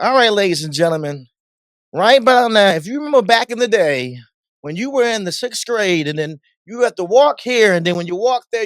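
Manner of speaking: high, projected preacherly address to the crowd; imitating a pastor-style host on the mic. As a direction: talk to the party like a congregation, no screaming. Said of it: "like you was a pastor".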